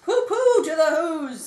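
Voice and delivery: mocking villain voice; gloating Grinch. A narrator's reading. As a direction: scoff at the Whos with glee. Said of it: "grinching, grinchishly humming".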